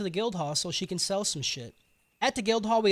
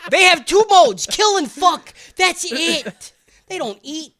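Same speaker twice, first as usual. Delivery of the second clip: high shouted list, easing toward the end; mock outraged rant; 6 semitones higher, 11 dB louder than usual. Goblin Slayer explaining goblins, shouted mock rant. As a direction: hammer out each point loudly.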